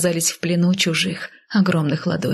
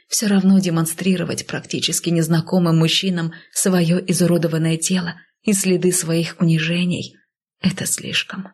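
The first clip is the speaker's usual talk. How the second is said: ordinary delivery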